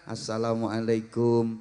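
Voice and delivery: low, soft, slow respectful greeting; bow and greet the teacher softly; humble bowing greeting. Shown as a demonstration. Pupil bowing to a teacher, soft humble greeting. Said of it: "sambil membungkukkan badan".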